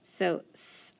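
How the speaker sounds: the s in "So, s" hissed with no voice; "So, s" with a voiceless hissing s; whispered s sound. Shown as a demonstration. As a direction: breathe out the s in "So, s" as a quiet hiss. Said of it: "it sounds like a S".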